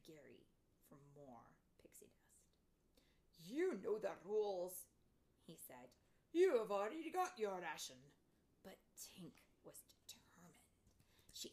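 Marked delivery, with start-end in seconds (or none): 3.4-4.9 slow, loud rule-keeper's voice; stern Fairy Gary. A narrator's reading. Fairy Gary refuses Tink more dust, stern. 6.3-8.1 loud put-on rule-keeper's voice; stern Fairy Gary. A narrator's reading. Fairy Gary turns down Tink's request, stern.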